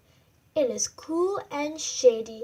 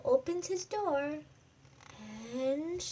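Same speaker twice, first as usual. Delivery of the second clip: ordinary delivery, nothing marked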